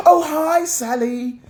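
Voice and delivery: high, chirpy Oh hi at work; mock polite colleague voice, high; posh work voice. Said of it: "we're at work and we're like".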